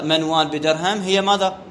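normal talking voice

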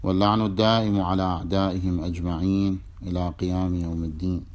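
measured devotional recitation to open the class; formal opening prayer. Acted. Teacher opening the lesson for students, devout recitation. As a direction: recite the invocation steadily.

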